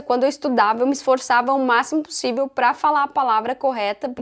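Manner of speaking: normal talking voice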